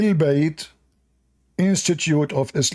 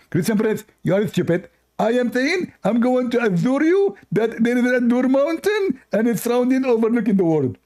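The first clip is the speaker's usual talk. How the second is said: high mocking caller voice, "I adore you" rising; mock Abdul voice; 8 semitones higher than usual. Mocking the caller Abdul, high put-on voice. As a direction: lampoon the caller, pitched high.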